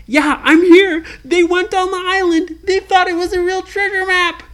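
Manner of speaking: high, loud eager voice; excited kid prisoner. Acted. Jack answering Ryan from the prison, high and eager. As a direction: call back glad to be found.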